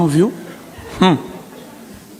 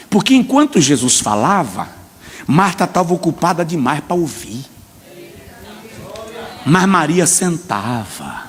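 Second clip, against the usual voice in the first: normal talking voice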